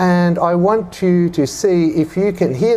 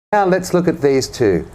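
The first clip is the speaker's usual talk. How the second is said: normal talking voice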